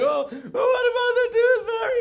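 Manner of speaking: very high wailing child impression; exaggerated high-pitched tearful whine; mock crying. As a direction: wail it like a devastated little girl, hammed up. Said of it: "and she's crying".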